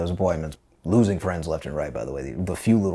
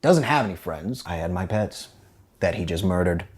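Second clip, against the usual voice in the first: usual speaking voice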